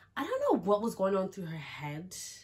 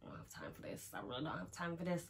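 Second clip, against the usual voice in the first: softer, half-whispered, fed up; exasperated under breath; 9 dB quieter, faster than usual. Retold or imitated. Muttering to her friend about the zip, fed up. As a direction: mutter it, done with it all. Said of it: "And I'm just like".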